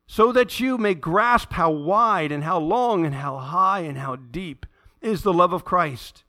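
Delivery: slow, solemn Bible reading; scripture reading. Acted. Pastor reading Paul's prayer to his church, slow and solemn. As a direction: read each phrase deliberately, with reverence.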